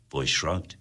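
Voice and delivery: ordinary delivery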